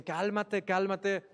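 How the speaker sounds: mocking imitation of people saying calm down.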